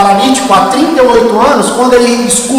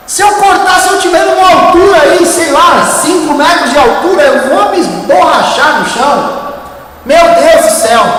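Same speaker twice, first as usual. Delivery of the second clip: high-pitched, alarmed exclaiming; panicked protest; 5 semitones higher than usual. Acted. Climber objecting to cutting the rope, in high-pitched alarm.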